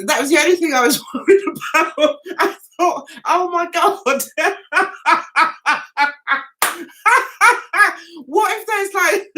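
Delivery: words breaking up into giggles; laughing while talking. Real, not acted. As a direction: crack up helplessly mid-story. Cracks up telling her worry.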